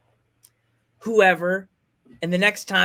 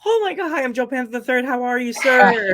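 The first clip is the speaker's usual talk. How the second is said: higher, nervous eager hello; starstruck greeting; 5 semitones higher, 4 dB louder than usual. Retold or imitated. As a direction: gush politely at a hero. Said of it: "I was freaking out".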